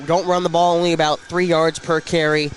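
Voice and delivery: normal talking voice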